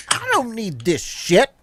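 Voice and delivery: grumpy dwarf. Retold or imitated. GM acts out storming dwarf.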